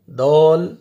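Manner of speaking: دال thickened to a rounded "dawl"; wrong heavy daal. Shown as a demonstration. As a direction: round the vowel of دال as a mistake. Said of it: "غلط، موٹا کر کے نہیں پڑھنا". (غلط، موٹا دال)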